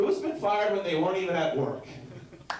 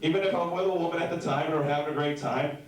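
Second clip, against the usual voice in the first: ordinary talk, nothing marked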